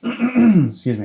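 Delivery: slow, hoarse, losing voice; strained hoarse voice after clearing throat. Real, not acted. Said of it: "I'm losing my voice".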